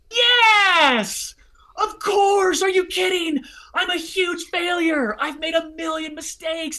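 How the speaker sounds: high, loud, overjoyed reassurance; eager yes. Retold or imitated. Answering the weeping man, joyful and eager. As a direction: burst out with forgiveness. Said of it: "I was like".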